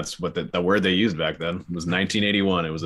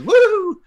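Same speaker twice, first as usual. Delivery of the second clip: very high, loud falsetto woo; gleeful imitated whoop; mock whoop; 10 dB louder than usual. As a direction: whoop like someone flipping into a lake. Imitating front flip cry.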